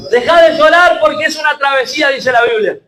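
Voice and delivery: rising shouted sermon, fervent and pushing; preaching at full force. Acted. Pastor rallying his church, shouting fervently. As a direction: hammer it home to the congregation.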